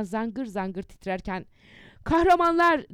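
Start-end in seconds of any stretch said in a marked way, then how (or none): 2.0-2.9 high, loud rallying cry; shouted address. A narrator's reading. Cemal Bey hails the cruiser's heroes, shouting. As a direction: call it out loudly, like hailing heroes. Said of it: "diye bağırdı".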